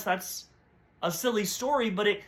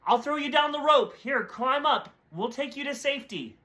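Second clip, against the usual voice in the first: higher, urgent call from above; rescuer yelling down; 7 semitones higher than usual. Acted. Helicopter rescuer yells down to man on roof, higher urgent call. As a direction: call down to him from the helicopter, pitched up. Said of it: "yelled down to the man".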